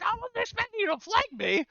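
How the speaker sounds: mock British yelp of surprise; squeaky British shock at being flanked; British accent. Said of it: "We'll just do that in a British accent".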